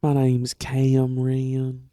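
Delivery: slow, loud southern drawl; southern accent. Shown as a demonstration. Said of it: "A little southern, 'cause I say".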